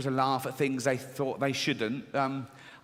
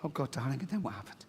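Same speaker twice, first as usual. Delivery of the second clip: hushed father; 8 dB quieter than usual. Acted. Father pressing for details.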